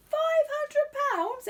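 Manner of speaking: put-on a child struck with awe; Judy at home, awed by the prize. A narrator's reading. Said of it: "in a tone of awe".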